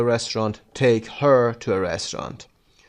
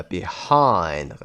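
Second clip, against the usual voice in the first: behind with HIND stretched and falling; light start, strong second syllable in behind; stress on second syllable; 4 semitones lower than usual. Shown as a demonstration. Teacher repeating the example for learners, second-syllable stress on behind. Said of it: "قسمت دوم".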